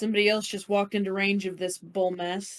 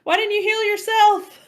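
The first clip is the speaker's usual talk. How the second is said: loud, high, incredulous protest; raised voice, pitched way up in disbelief; exasperated outcry; 11 semitones higher, 9 dB louder than usual. Real, not acted. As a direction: cry out in exasperation at a teammate. Game master scolds healer who fell.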